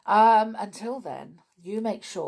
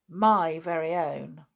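proud; 7 dB louder than usual. Acted. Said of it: "said George proudly".

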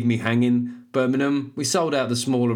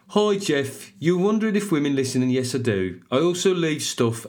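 high-starting, singsong Brummie; Brummie accent; 3 semitones higher than usual. Acted. Reading a listener's review as her, Brummie accent. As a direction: put on a Birmingham accent. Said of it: "it's gonna be a Brummie accent".

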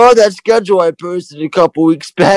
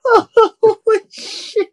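squeaky, breathless laugh-talk; words squeezed out through laughter; laughing hard; much slower than usual. Real, not acted. Laughing hard at Pacino impression.

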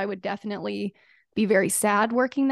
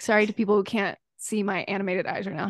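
normal talking voice

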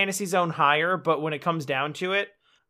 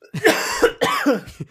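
hard cough, something went down wrong; choking cough; 6 dB louder than usual. Real, not acted. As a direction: cough it out after a gasp. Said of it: "the gasp went down the wrong way".

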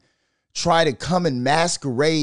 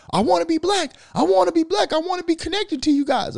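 much higher, mocking whiny wannabe voice; squeaky, pleading mock of an eager poser. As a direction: ridicule the wannabe in a very high voice. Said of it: "Would you still be saying".

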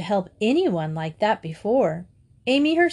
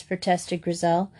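plain reading voice

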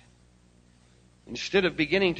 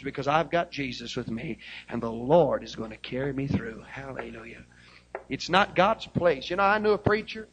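ordinary talk, nothing marked

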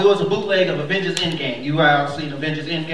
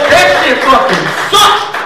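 loud, slow, higher yell about working; shouting rant; 7 semitones higher, 9 dB louder, slower than usual. Real, not acted. Comic complaining to the crowd about working through the pandemic, shouting. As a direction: hammer each word out in anger.

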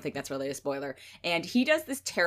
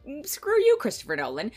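sarcastic dismissal; 7 semitones higher than usual. Retold or imitated. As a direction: brush him off with mock contempt. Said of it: "part of me is like".

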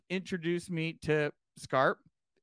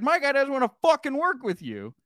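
heated retort; 5 semitones higher, 9 dB louder than usual. Retold or imitated. Arguing with Steve in ring.

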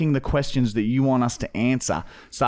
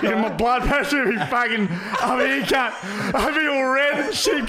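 laughing while talking; 12 semitones higher than usual. Real, not acted. Cracking himself up with a fart joke.

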